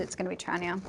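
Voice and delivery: ordinary talk, nothing marked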